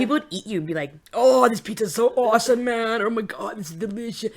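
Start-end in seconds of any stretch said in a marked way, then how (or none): 1.1-4.3 lightly voiced stoner raving. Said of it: "Sounds like a stoner".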